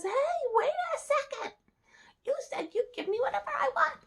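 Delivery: very high-pitched demanding frog; high little frog voice, insistent; indignant frog. Acted. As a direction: pipe up high, objecting to being left. Frog demands promised reward.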